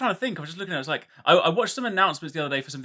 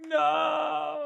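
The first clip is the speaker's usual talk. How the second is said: despairing cry; 12 semitones higher than usual. Retold or imitated. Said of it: "I'm just like".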